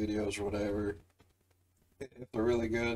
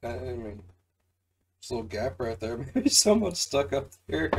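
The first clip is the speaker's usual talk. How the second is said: ordinary delivery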